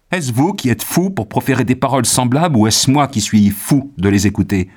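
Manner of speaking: loud, impassioned, high-pitched tirade; angry outcry. A narrator's reading. Young man defending his dead beloved from mutilation, angry. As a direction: hurl outraged accusations at full voice. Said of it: "s'écria Arthur avec colère".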